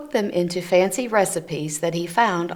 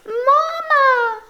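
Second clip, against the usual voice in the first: much higher, louder squeaky "Mama!"; baby gosling; 5 dB louder than usual. Acted. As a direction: call out like a tiny newborn gosling. Newly hatched gosling calls Bruce.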